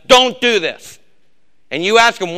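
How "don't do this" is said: loud, high, barked prohibition; stern prophet command. Retold or imitated. Prophet forbids the crowd, loud stern command. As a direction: thunder it like a fiery preacher. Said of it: "The prophet does like a preacher does".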